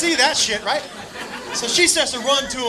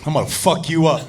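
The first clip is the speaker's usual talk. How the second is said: much lower, contained acted anger; mock menacing threat; 9 semitones lower than usual. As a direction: threaten like a man who just snapped. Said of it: "something in him just snapped".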